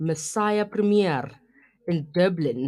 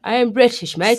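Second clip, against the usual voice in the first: put-on louder, playful British accent; mock British accent; 2 semitones higher, 7 dB louder than usual. Host teasing co-host about being British, in a mock British accent. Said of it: "You're killing it with this accent".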